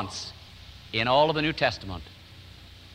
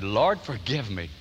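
humble prayer; 7 semitones lower, slower than usual. Retold or imitated. Retold prayer of repentance.

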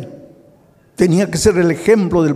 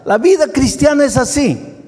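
preaching; 11 semitones higher, 3 dB louder than usual. Real, not acted. Preaching at full force.

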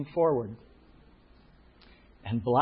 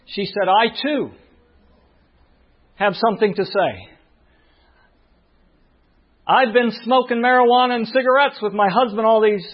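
high, loud testimony at full force; ringing high-pitched sermon storytelling; impassioned preaching; 9 semitones higher, 7 dB louder than usual. Real, not acted. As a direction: preach it up high, driving louder as each confession comes.

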